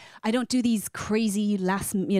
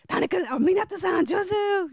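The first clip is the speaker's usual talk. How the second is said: excited praise; 11 semitones higher than usual. Retold or imitated. Cheering colleague at karaoke.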